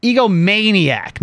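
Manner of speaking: slow, emphasized. Real, not acted. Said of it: "really think about it".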